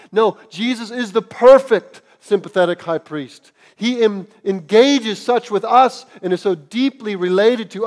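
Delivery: rising sermon fervour; impassioned preaching. Real, not acted. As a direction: preach it out with conviction. Preaching climax.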